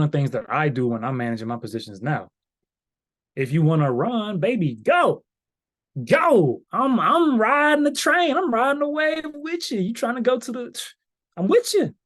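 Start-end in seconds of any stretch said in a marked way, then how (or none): 3.4-11.9 high, loud hype-man voice; hyped cheering. Acted. Trader cheers on a running trade, hyped up. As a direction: ride the excitement way up high, stretching the words.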